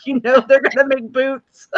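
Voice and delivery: knowing, teasing quote; mock warning. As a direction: tease them with a slow, sly warning. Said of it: "And I was like".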